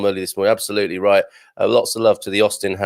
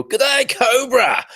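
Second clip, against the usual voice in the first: higher, slow hammed-up Australian shout; mock Australian; 14 semitones higher, slower than usual. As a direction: bellow a jokey Australian greeting. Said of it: "not Australia".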